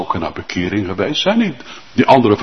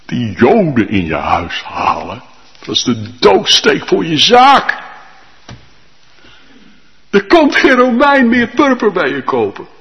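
a hint of a shocked neighbour, higher; alarmed onlooker; 7 semitones higher, 4 dB louder than usual. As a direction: warn her in disbelief. Onlookers warn Lydia. (een gealarmeerde buurman waarschuwt Lydia, verbaasd)

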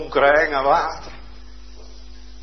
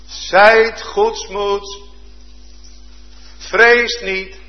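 slow, solemn comfort, a little higher; calm reassurance; 7 semitones higher, 6 dB louder, much slower than usual. Acted. Jesus calming his terrified disciples on the water, slow and solemn. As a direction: lay each word down gently and firmly.

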